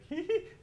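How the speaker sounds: short, very high giggle; a loud "hee" that escapes; squeaky burst of helpless laughter; uncontrollable giggle. Acted. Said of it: "just can't control it".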